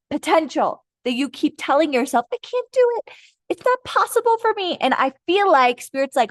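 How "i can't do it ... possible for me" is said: high, put-on helpless voice; mock self-doubt. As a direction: imitate a helpless whiner, pitched way up. Voicing listener's self-doubt.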